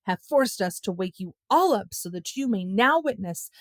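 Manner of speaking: usual speaking voice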